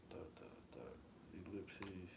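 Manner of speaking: a breathy, voiceless whisper; whispering the subtitle punctuation for suspense. Pretend. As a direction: hush it like a secret. Reading the subtitle dots aloud.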